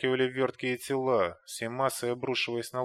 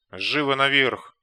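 loud slow command; shouting orders over battle; 7 dB louder, slower than usual. A narrator's reading. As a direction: bark the order to climb. Приказ в бою. (громкий приказ)

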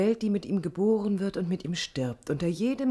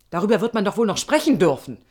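angry; 8 dB louder, faster than usual. Acted. Heated argument with the planner.

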